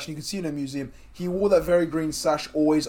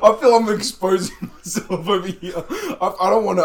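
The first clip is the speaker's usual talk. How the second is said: words broken by sheepish laughter; nervous laugh through the words; embarrassed laughing; 4 semitones higher, 7 dB louder than usual. Real, not acted. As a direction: giggle while admitting it. Embarrassed about pie habit.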